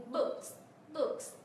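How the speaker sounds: "books" slowly, with K and S both sounded; full final cluster. Shown as a demonstration. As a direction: model "books" ending on K plus S. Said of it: "phát âm hai cái phụ âm này cùng một lúc".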